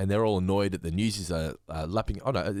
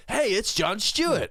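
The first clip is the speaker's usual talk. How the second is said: higher, slow, hammed-up clown announcement; mock Krusty; 9 semitones higher, 4 dB louder, slower than usual. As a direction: announce him like a cheesy clown host. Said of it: "right, and says".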